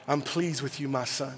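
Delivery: soft, low, tender whisper; tender voice of God. Retold or imitated. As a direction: speak it gently as a loving father. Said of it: "God telling me".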